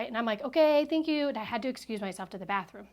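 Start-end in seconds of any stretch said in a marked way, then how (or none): 0.4-1.3 higher, louder put-on thanks; retold forced politeness. Upset guest excusing herself to the bathroom, retold forced politeness. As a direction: imitate a polite exit while upset.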